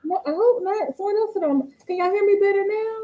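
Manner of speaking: muffled like in a tunnel. Real, not acted. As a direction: voice sounds far off and muffled. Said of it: "You're so muffled".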